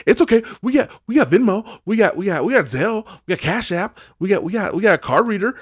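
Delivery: put-on high, loud eager kid voice; pushy little girl. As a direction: rattle it off like a tiny hustler. Girl scouts listing payments.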